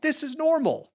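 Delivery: mock cheerful. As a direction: sing it out like nothing's wrong. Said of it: "you know that it was like".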